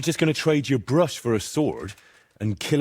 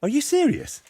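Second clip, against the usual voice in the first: much higher, stunned disbelief; incredulous. Acted. Henry reacts in disbelief to his friend's secret. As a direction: shoot up in pitch in shock.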